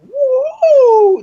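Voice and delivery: long, high, loud mock "woo"; imitated woman's whoop. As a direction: let out a drawn-out high whoop, imitating her. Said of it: "but that noise".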